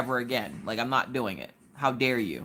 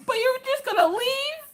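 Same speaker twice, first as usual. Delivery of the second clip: much higher, slow, hammed-up whine; mock whimper. Newlywed wife to husband going to work, mock whiny plea. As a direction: plead like a deserted puppy. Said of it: "he'd be like".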